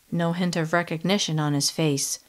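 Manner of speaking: plain reading voice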